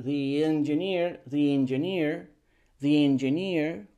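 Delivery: "the engineer" with thee flowing into "engineer"; no break after "the", ee glides on; linked "thee". Shown as a demonstration. Teacher repeats the link for students, thee engineer. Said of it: "link de the".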